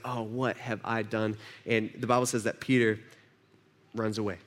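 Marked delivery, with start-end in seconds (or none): 0.0-1.4 soft, slow, quiet regret; a broken whisper of guilt; remorse. Acted. Peter realizes his betrayal.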